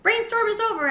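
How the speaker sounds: high, loud call across the room. A narrator's reading. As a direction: call over to the frog. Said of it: "I called to Og".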